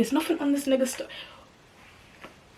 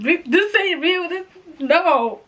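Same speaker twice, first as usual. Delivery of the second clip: high, frantic disbelief; hammed-up refusal to believe; acted shocked denial; 7 semitones higher than usual. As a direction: protest in a rising, high-pitched panic. Acts out her shocked denial.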